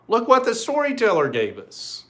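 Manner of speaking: high, bright-eyed boast of a gift; excited kids. Acted. Brothers show dad the mossy rock, excited and high.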